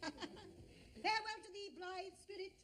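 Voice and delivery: slow, hammed-up stage actor's exit line; grand theatrical farewell. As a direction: draw out the farewell like a pompous ham actor.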